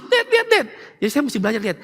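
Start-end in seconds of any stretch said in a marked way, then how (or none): 0.0-0.7 put-on high, pleading child calling for dad; little child calling. Preacher imitates his small child calling dad, high and pleading. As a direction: play the little kid begging dad to look. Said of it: "sampai mau tidur masih".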